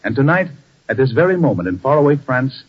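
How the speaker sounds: announcer introduction. Acted. Radio host introduces the broadcast.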